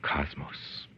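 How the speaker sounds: whispered, dry one-word answer; whispered aside. Acted. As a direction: breathe it out under your breath. Sardonic aside to himself.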